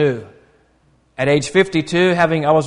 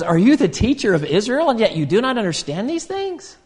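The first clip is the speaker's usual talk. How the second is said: higher-pitched, questioning mild reproach; inviting rebuke; 6 semitones higher than usual. Retold or imitated. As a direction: imitate a teacher's rebuke that still invites. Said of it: "a rebuke, but also an invitation".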